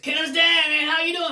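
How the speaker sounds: high, loud eager fan greeting; a couple spotting him outside a shop, calling out high and loud; excited fan. Retold or imitated. Fans greeting him on the street.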